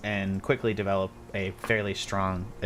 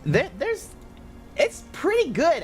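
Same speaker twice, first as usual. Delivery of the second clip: servant voice; 3 dB louder than usual. Acted.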